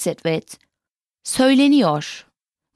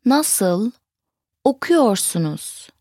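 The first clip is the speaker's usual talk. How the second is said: "nasıl", pause, "okuyorsunuz"; word by word; slower than usual. Shown as a demonstration. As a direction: separate "nasıl" and "okuyorsunuz" for learners. Teacher breaks phrase into words.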